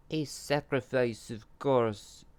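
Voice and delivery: high, loud impish voice. A narrator's reading. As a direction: play a cackling little imp. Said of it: "Imp Two explained".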